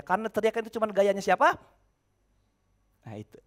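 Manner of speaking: ordinary delivery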